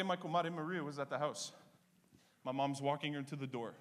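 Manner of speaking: normal talking voice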